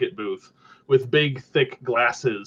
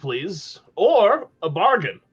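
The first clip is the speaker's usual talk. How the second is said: put-on much higher old goblin voice; high elevated goblin voice; 8 semitones higher than usual. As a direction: keep the goblin pitched way up. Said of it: "in an unnecessarily high, elevated voice".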